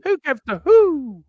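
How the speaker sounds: high, slow hooted who; mock hooting who. Real, not acted. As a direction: stretch the who up high like a hoot. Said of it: "All I heard was a who".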